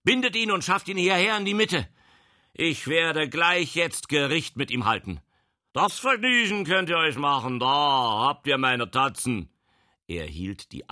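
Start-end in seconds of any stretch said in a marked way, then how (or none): 0.0-5.2 high, loud, imperious order; commanding shout. Acted. Official orders the prisoner bound. 5.7-9.5 loud, high, defiant retort; defiant shout. Acted. Prisoner loudly defies his captors.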